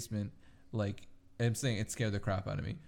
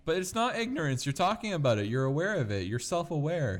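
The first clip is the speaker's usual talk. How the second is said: raised, insistent pushback; higher, louder, pressing the point; exasperated; 7 semitones higher, 5 dB louder than usual. Real, not acted. Arguing about investigating the house.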